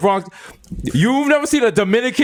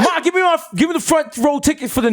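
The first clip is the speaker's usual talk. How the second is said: yelling; 10 semitones higher than usual. Pretend. Acting son yelling.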